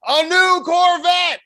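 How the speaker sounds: loud, high, drawn-out announcer shout; mock announcer unveiling the big prize, stretched out and booming; game-show prize reveal. Host reveals door number three to contestant, big announcer shout.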